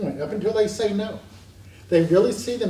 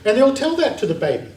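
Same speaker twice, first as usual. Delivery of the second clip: normal talking voice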